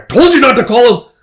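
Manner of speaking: high, loud outburst; indignant shout. Acted. Brother objecting to brother-in-law label, indignant shout. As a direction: snap it out in acted outrage.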